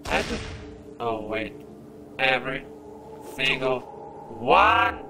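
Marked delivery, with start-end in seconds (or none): 2.2-5.0 rising exasperation, ending in a cry; exasperated emphasis. Real, not acted. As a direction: punch each word separately, loudest on the last. Gamer exasperated mid-fight.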